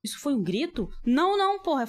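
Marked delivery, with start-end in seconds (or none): 1.1-1.9 loud, high cry of real fright; startled yell. Real, not acted. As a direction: jolt at the sudden scream. Startled by scream in game.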